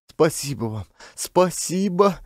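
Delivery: meek gratitude rising high; babbling thanks. Acted. Varvara thanking her rescuer Klin, grateful babble. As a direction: murmur thanks, then lift it high. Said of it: "не переставала лепетать Варвара".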